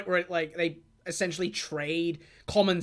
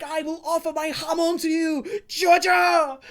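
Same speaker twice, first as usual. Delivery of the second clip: Caesar's last cry; 8 semitones higher than usual. Retold or imitated. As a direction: give everything in one final call. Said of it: "he's like".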